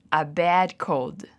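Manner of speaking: very slow, deliberate model phrase; fala lenta de modelo. Shown as a demonstration. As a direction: draw it out so the class can copy. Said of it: "So, repeat". (frase modelo bem devagar para repetir)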